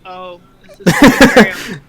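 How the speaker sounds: loud laughing reaction. Real, not acted. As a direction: burst out laughing mid-sentence.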